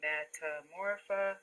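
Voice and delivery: "Metamorphosis" slowly sounded out in separate pieces; syllable by syllable. Shown as a demonstration. Teacher walks pupils through the word, "Metamorphosis" syllable by syllable. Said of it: "do our syllables for metamorphosis".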